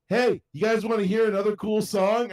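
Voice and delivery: high, loud retold call to the room. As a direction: pester them with mock enthusiasm. Drunk, replaying song to family.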